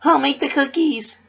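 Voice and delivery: very high, loud bubbly squeal; chipper Pinkie. Acted. Pinkie answering Missus Cake, high and bubbly. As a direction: bounce it up bright and sweet.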